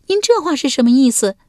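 high, coy court lady voice; lady Wenjiang. A narrator's reading. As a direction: answer sweetly as the young wife. (文姜娇声作答)

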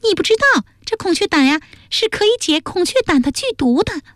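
put-on very high, petulant girlish voice; exasperated, high-pitched explanation; impatient young woman. A narrator's reading. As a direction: insist like a foot-stamping girl. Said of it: "伽罗跺脚说".

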